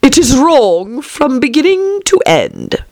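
high, slow, emphatic verdict; decided caterpillar. A narrator's reading. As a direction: pronounce it firmly, drawing out the words. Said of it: "said the caterpillar decidedly".

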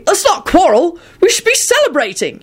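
high, cheery appeal; rally them in a lifted pitch; happy. A narrator's reading. Arthur urging everyone to celebrate, happy. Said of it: "he added happily".

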